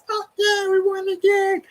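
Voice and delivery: high, squealing cry of triumph; gleeful victory whoop. Retold or imitated. Sitting at home after the win, squealing with high joy. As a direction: shriek it like your team just won.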